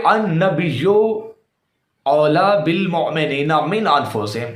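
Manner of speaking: "النبیو" with -yo, then the verse slowly; careful recitation. Shown as a demonstration. Repeating the verse he read before opponent, careful recitation. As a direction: recite measuredly, drawing "اولا" out. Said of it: "میں نے اس کے سامنے کہا".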